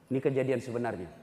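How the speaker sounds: usual speaking voice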